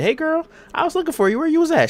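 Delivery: very high, girlish put-on voice; mock friendly greeting. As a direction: imitate a woman hailing her friend. Voicing wrestler greeting former friend.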